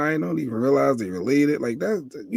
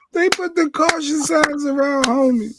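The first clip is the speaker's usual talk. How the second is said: high, slow, drawn-out amused cry; amused disbelief; 11 semitones higher, slower than usual. Real, not acted. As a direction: cry it out high, close to cracking up. Cracking up at the knockout clip.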